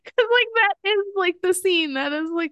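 high, squeaky laugh through the words; laughing while talking. Real, not acted. Friend cracking up over the funniest scene, laughing helplessly. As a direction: barely get it out for laughing.

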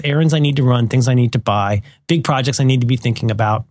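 ordinary talk

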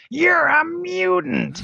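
shrill-free squeaky villain yelp, drawn out slowly; high-pitched Creed. Retold or imitated. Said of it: "that high pitch mutant stuff".

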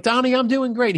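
high, eager imitation of his younger self; eager reply. Young rep answering his boss, high and eager. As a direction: pitch it up like a keen young rep. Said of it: "I'd be like".

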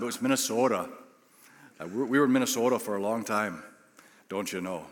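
lightly put-on Minnesota accent, low and easy. Shown as a demonstration. Former Minnesotan shows congregation his old Minnesota accent. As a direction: conjure up a hint of Minnesota accent. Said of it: "accent from that time".

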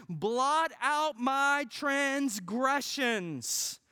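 slow, prayerful psalm reading; slow, solemn reading of a psalm; scripture reading. Acted. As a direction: draw each phrase out like a prayer. Reads psalm aloud slowly.

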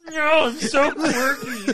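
put-on very high, slow quirky girl voice; hammed-up high Kermit-like girl; Zooey Deschanel. Comedian defending his impression to friends, high and quirky. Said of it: "that's your Zooey Deschanel impression".

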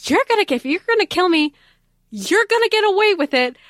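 high, quick, cheeky threat ending in a laugh; playful Cassandra voice. Retold or imitated. Fan voicing Cassandra defying her would-be killer, sassy. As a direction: taunt the killer with sassy defiance. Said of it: "She's like".

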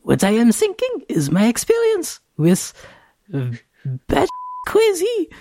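put-on high, squeaky little kid voice; a small child explaining seriously; preschooler. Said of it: "you have to use your little kid voice".

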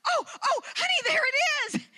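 high, eager, pointing it out; excited pointing out. Retold or imitated. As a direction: spot the fish and urge him on. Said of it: "And I'm like".

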